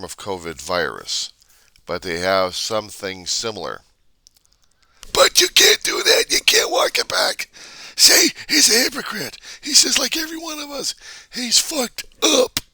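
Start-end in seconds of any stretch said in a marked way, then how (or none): 5.1-12.6 very high, loud, squawking voice; screeching mock accuser. Vlogger mimicking online mob shouting at author. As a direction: screech like a frantic troll.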